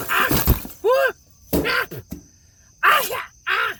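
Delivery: high, loud cries and yelps; pained yelps. Acted. Fighting Gregor again, yelling in pain, high and loud. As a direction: yelp and holler while fighting. Fight resumes, getting hurt.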